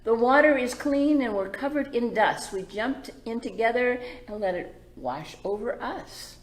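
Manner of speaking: bright, lilting story read; gentle read-aloud for the little ones; reading to children. A narrator's reading. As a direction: read it like a picture book to kids. Reads picture book aloud.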